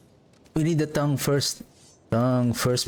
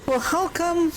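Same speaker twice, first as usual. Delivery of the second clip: surprised; 13 semitones higher, faster than usual. Real, not acted. Surprised puzzlement.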